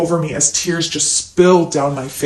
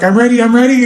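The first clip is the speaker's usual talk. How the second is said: higher, louder movie-style eagerness; movie lover; 6 semitones higher, 6 dB louder, faster than usual. Retold or imitated. Narrator mimicking a film heroine before sex, eager movie voice. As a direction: announce it like a keyed-up movie lover. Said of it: "It's like in the movies".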